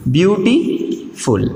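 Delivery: Beautiful very slowly, with gaps between view, टी and फुल; teacher splitting beautiful into its three syllables. Shown as a demonstration. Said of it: "टी दूसरा syllable और फुल". (Beautiful धीरे-धीरे, ब्यू-टी-फुल अलग करके)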